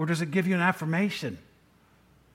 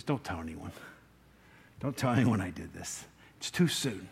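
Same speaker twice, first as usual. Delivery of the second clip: hushed secret warning; 11 semitones lower, 8 dB quieter than usual. Acted. Jesus secretly telling them to hush.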